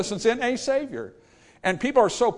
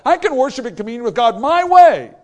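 rising preaching; 8 semitones higher, 10 dB louder than usual. Real, not acted. Preaching at full force.

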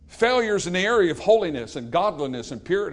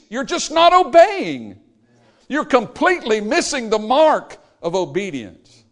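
higher, louder and slow; exasperated rebuke; 5 semitones higher, 5 dB louder than usual. Acted. Preacher scolding the congregation for not obeying, loud and slow.

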